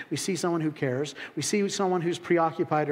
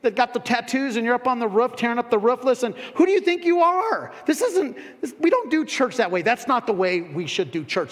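scolding critics; 6 semitones higher, 4 dB louder than usual. Retold or imitated. Critics scolding the roof-breakers.

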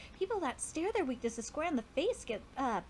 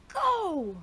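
shout; 5 semitones higher, 7 dB louder than usual. Acted. Rallying himself at a game.

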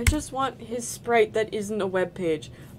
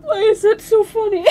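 high, loud laughing wail; crying laughing; 11 semitones higher, 7 dB louder than usual. Real, not acted. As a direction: ask it while helpless with laughter.

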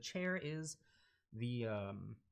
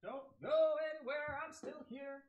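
far off. Real, not acted. Calling out while away from mic.

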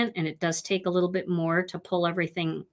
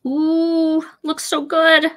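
delighted high ooh; thrilled at the result; excited; 9 semitones higher, 8 dB louder, much slower than usual. Real, not acted. First look at finished die cut.

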